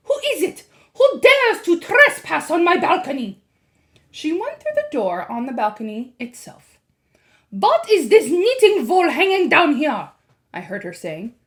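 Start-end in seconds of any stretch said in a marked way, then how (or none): 0.1-3.4 high, loud, menacing witch voice; a furious witch demanding answers; Grand High Witch, indignant. A narrator's reading. 7.5-10.1 high, loud, menacing witch voice; a furious witch demanding answers; Grand High Witch, indignant. A narrator's reading. Witch on the balcony.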